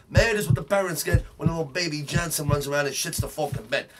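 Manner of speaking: ordinary talk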